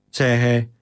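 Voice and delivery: usual speaking voice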